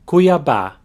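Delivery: Cuiabá said kwee-ah-BAH, stress on the last open A; Portuguese pronunciation. Shown as a demonstration. Teacher models the whole city name for students, stressing the end. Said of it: "Putting it all together".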